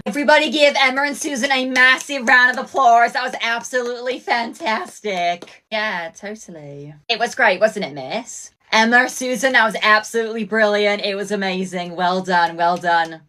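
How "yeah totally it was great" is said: usual speaking voice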